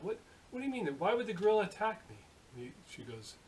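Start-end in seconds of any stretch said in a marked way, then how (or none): 0.0-2.2 high, puzzled protest; incredulous questioning. Acted. Machinist hears from nurse he was attacked, incredulous. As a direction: push back in mild disbelief. Patient disputes nurse's explanation.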